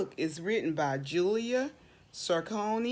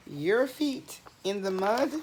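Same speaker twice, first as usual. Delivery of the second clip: usual speaking voice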